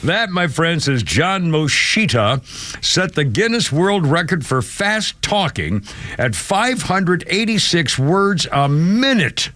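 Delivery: slow, drawn-out announcer hype; showman announcer. Acted. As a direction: present it like a record-breaking act, slowly.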